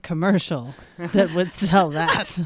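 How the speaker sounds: ordinary talk, nothing marked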